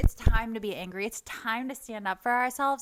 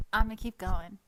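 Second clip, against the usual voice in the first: ordinary talk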